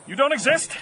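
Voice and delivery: loud, high, desperate denial; frantic yelling. Acted. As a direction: shout them away. Man yells at hallucinated birds.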